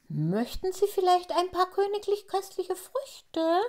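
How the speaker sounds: high and hurried; quick, nervous question. A narrator's reading. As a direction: rush the offer nervously. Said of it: "fragt Tafiti schnell".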